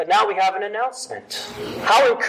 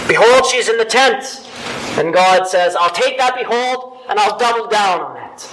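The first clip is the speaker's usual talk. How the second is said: emphatic proclaiming; 6 dB louder than usual. Acted. Preacher voicing God's emphatic reply.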